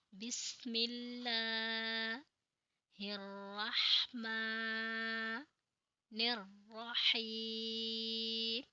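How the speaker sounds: very slow, drawn-out Arabic recitation; measured Quranic chant of the basmala; slow tajweed recitation. Shown as a demonstration. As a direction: recite slowly, lengthening every vowel. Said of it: "तावुद और तस्मिया".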